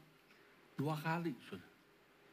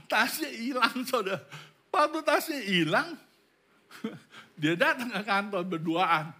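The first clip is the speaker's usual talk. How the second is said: high, loud telling between laughs; amused; 8 semitones higher, 7 dB louder than usual. Real, not acted. Preacher recalling the lost bag, laughing. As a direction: tell it through bursts of laughter, pitched up.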